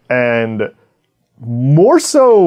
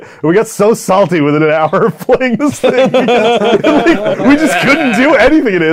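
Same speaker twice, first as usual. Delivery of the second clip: laughing; 11 semitones higher, 4 dB louder than usual. Real, not acted. Laughing while recalling the frustration.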